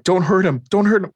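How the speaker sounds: high, urgent begging; retold panicked protest; mock frantic plea. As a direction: plead for his life, hammed up. Daughter pleading in retold scene.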